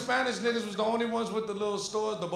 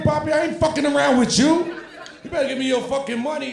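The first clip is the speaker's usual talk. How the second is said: louder, higher frustrated demand; angry robber threat; 6 semitones higher, 8 dB louder than usual. Acted. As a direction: lose patience and yell it at him.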